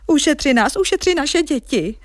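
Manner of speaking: high, pleading cry; storybook crowd crying for mercy; villagers calling out. Acted. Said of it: "volali lidé".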